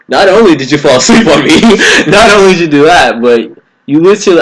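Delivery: high, loud annoyed scolding; frustrated telling off. Retold or imitated. Friend who fell asleep on him: loud, high scolding. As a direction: lay into him, laughing a bit. Confronting sleepwalking friend, angry.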